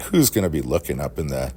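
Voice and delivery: ordinary delivery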